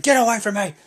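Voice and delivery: high, loud mock snarl; angry brush-off. [name] telling the crowd to back off, loud mock anger. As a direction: push them off with a hammed-up growl of rage. [name] shoving people away.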